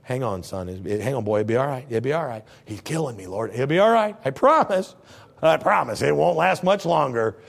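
low, gentle reassurance, a hint of a tender voice; calm a suffering boy; soothing whale. Whale to God and Jonah, mild soothing reassurance. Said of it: "conversation between the Lord and the whale".

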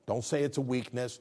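ordinary delivery

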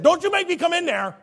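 high-pitched scolding mom, loud; mom's warning. Retold or imitated. Mother warning her child not to make her come in, high scolding shout. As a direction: threaten like a fed-up mother. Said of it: "My mom would say that".

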